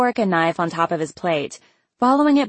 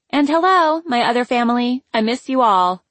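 cheerful greeting; 6 semitones higher, 4 dB louder than usual. A narrator's reading. Friend greets family cheerfully.

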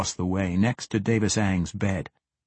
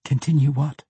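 higher voice for a captive woman; wary question; 6 semitones higher, 4 dB louder than usual. A narrator's reading. Captive woman asks what the assassin wants, wary question. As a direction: raise the pitch, puzzled and on edge.

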